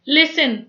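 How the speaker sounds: "लिसन" with only an s in the middle; silent T. Shown as a demonstration. Teacher reading the example, "लिसन" with the t silent. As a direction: say "लिसन" with no t sound. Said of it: "टी silent है".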